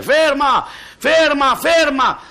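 loud, high alarmed yell; baker's boy yelling at the old man to stop, high and loud; shouting. Acted. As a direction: scream the warning to the old man. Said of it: "comincia a gridare".

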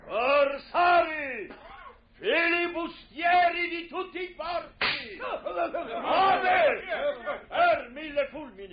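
high rallying pirate cry; calling out. Acted. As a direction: rouse the crew. Capitano chiama la ciurma.

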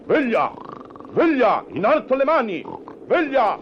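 huge loud barked command; booming robber voice. Acted. Masked robber booming at sleeping couple. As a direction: wake them up with a booming threat. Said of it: "ti dicono con una vociona potente".

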